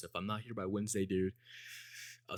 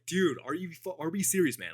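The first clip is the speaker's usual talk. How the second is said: high-pitched, exasperated incredulity; mock-appalled, can't believe the obvious lie; acted disbelief; 8 semitones higher, 4 dB louder than usual. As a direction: retell your stunned reaction, pitched right up. Acts out disbelief at friend's lie.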